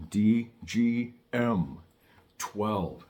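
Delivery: each letter slow and separate; slow spelled-out code. Shown as a demonstration.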